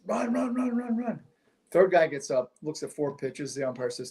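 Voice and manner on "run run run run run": excited calling out. Retold or imitated.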